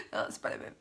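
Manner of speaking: breathy joking whisper; whispered aside. Acted. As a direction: whisper it like a secret joke. Joking aside after dentist quote.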